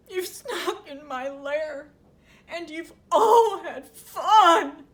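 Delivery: hammed-up blubbering complaint; crying bear. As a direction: wail it slowly like a sulky, weeping bear. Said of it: "He's crying".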